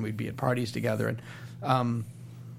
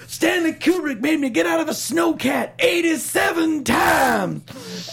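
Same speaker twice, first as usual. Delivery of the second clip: much higher, loud exasperated shout; 14 semitones higher, 5 dB louder, slower than usual. Retold or imitated. [name] complaining to [name] in a high, loud shout. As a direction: shout it high like an outraged guest. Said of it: "my impression of [name]'s impression of [name]".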